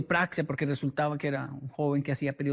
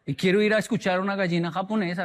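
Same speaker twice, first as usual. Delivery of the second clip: ordinary delivery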